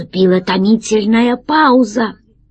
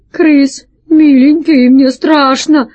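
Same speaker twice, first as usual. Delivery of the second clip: high, louder pleading whimper; frightened; 6 semitones higher, 5 dB louder, slower than usual. Acted. Pirate begging his captain in the dark, high scared plea. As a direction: beg the captain out of fear.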